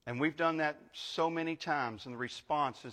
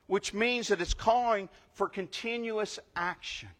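higher and louder, driving pulpit delivery; full-force preaching; 5 semitones higher, 6 dB louder than usual. Acted. Pastor expounding Thessalonians to the church, fervent preaching. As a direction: press each point home to the congregation.

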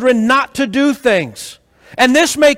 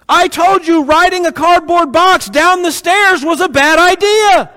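put-on a parent lecturing a hurt child; told-you-so parent; 5 semitones higher than usual. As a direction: scold the kid with mock glee.

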